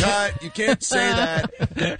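slow, high-pitched mock call of cut; imitated exasperated director. Crew scolding actor mid-take, mock exasperated. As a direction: halt the take in comic disbelief, drawn out.

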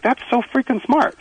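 high-pitched retold amazement; impressed. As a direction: marvel at the clever trick. Retells his admiring reaction.

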